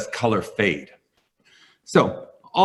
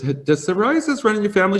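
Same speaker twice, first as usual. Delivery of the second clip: imitating the doctor; 11 semitones higher than usual. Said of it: "she goes".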